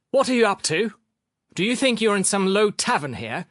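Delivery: high, loud indignant telling-off; angry scolding. A narrator's reading. Doctor scolding Sharikov in the room, indignant.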